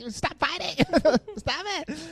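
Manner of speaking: high-pitched damsel squeal; hammed-up helpless pleading; mock protest. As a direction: squeal it, half laughing. Acts out Elizabeth breaking up fight.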